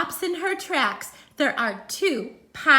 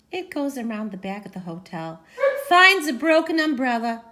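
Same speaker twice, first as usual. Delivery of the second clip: usual speaking voice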